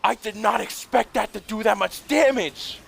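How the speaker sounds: high-pitched disbelief all the way through; amazed exclamation. Real, not acted. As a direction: exclaim it high, astonished by the result. Amazed by the weapon's damage.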